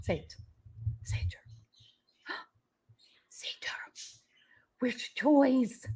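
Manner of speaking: soft excited whisper; whisper to dog. Real, not acted.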